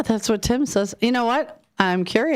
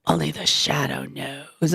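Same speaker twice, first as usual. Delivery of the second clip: low, slow creepy narrator; a hint of a sinister announcer; spooky radio voice; 4 semitones lower than usual. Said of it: "with his cute little boy voice".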